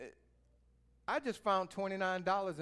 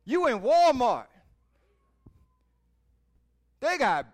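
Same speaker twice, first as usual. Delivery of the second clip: loud, higher, driving sermon; fiery preaching; 10 semitones higher, 10 dB louder than usual. Acted. Preacher challenging the congregation about praise, loud and fiery. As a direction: preach it hard at the crowd.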